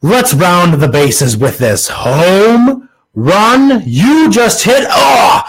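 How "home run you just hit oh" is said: high, drawn-out mock home run call; rising excited shout; hyped announcer. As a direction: stretch it out like a hammed-up sportscaster.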